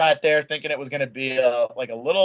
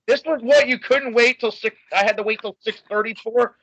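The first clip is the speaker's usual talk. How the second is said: mock indignation; 7 semitones higher than usual. Mock-indignant retelling to Simon.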